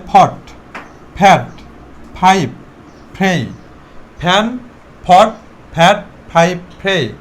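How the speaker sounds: ফট, ফ্যাট, ফাইভ, ফ্রেই each opening on a puffed P; aspirated P drill. Shown as a demonstration. Teacher drills the five-word list, aspirated P. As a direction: separate words, a burst of air on every P in ফ্যান, ফট, ফ্যাট. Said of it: "আমরা ফ উচ্চারণ করব".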